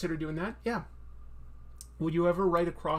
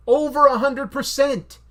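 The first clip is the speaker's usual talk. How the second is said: high, loud, slow boast; emphatic shout; 9 semitones higher, 13 dB louder than usual. Real, not acted. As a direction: belt it out with total conviction. Repeats boast much louder.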